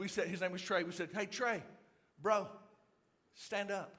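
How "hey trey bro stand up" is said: unhurried, matter-of-fact, pausing between phrases; deadpan reply. Retold or imitated. Friends to panicking Trey, deadpan and unhurried. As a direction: say it evenly, against all the panic.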